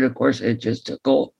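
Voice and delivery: normal talking voice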